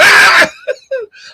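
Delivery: high, loud laugh right after the roar; loud laugh burst. Real, not acted. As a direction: burst out laughing loudly at your own joke. Mocking the triggered chatter.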